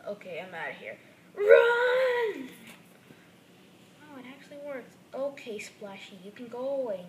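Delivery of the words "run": much louder, higher laughing scream; panicked shout. Acted. Scared toy shouts and runs from the ghost dolphin. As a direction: yell it while fleeing in mock terror.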